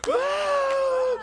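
high mock sob; imitated crying wail. Man overcome by God's word, imitated high wail. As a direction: wail out a retold cry. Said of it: "He's crying".

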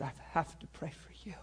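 near-whispered offer of prayer; hushed, earnest. Retold or imitated. Pastor offering to pray for her, near whisper. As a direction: drop to a soft, breath-only murmur.

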